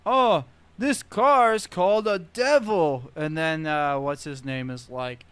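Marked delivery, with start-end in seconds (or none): 0.0-3.1 hammed-up Horace. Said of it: "he's like".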